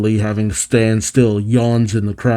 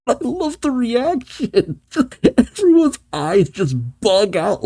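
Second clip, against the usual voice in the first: laughing; 12 semitones higher than usual. Real, not acted. Laughing at the reaction shots.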